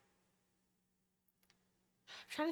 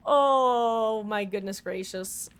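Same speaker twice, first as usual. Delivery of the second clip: exaggerated exasperation; 6 dB louder, much slower than usual. Real, not acted.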